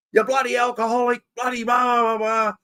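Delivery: imitating mum yelling at him for drinking the rum; mum going off. Mum scolding her son for drinking the Bundy, mock angry yell. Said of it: "She got up me big time".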